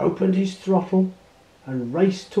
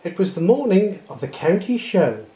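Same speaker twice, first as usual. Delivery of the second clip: ordinary delivery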